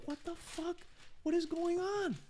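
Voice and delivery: squeaky-high disbelief; retold shock. Bartender after a customer threw the tray, high-pitched shocked disbelief. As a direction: shoot the voice way up, stunned.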